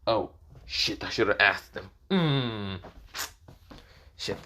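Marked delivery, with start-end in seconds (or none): none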